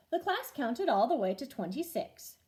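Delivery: usual speaking voice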